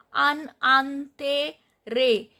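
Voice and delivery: "an antere" very slow, split into syllables. Shown as a demonstration. Teacher models the French word, slowly separating each syllable of "an antere". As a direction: draw out "an antere" piece by piece.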